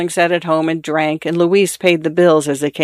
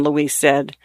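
ordinary delivery, nothing marked

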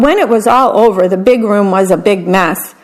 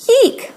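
a sudden high yelp; high yip; 14 semitones higher than usual. A narrator's reading. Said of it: "Pip yipped".

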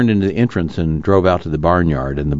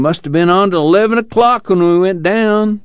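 put-on higher, tired old man still complaining; moaning; 3 dB louder than usual. A narrator's reading. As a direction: keep moaning, just woken and grumpy. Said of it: "he moaned".

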